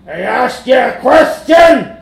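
loud, slow, menacing bellow; shouting monster. A narrator's reading. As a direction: bellow it like a thug. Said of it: "said the monster".

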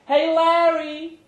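high, slow woman's call; Brenda calling out. Acted. Brenda greeting Larry at the door, high call. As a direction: call him in from behind the bar. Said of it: "called Brenda".

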